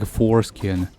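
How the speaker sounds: ordinary talk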